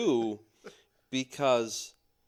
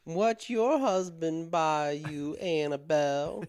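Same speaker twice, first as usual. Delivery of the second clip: higher, genteel Southern belle asking a friend; Southern lady; 5 semitones higher than usual. Acted. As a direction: play a nosy Southern lady. Southern ladies joke.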